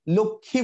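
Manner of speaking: "লক্ষ্মী" said lok-khi, no sh or m; Bengali pronunciation. Shown as a demonstration. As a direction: round o and doubled kh in "লক্ষ্মী". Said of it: "বাংলায় আমরা".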